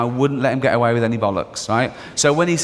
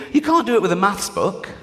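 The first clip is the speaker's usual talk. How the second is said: usual speaking voice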